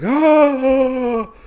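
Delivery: long, high, loud "oh"; drawn-out imitation of a shocked gasp; mock shock. Wolf seeing his captive being freed, mock shocked cry. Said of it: "the wolf gets really, like, shocked".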